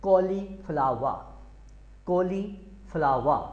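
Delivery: slow कॉलीफ्लावर ending in an open a; कॉलीफ्लावर said slowly without the r; no final r. Shown as a demonstration. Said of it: "आर आपने बाद में नहीं बोलना है".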